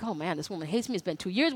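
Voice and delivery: retold nerves, voice dropped low and soft; nervous worry. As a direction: mutter it low as if scared to be heard. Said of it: "I started to get nervous now".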